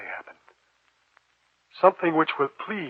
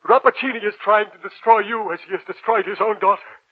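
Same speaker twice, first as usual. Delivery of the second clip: urgent warning; 6 semitones higher, 8 dB louder than usual. Acted. Professor urgently warns.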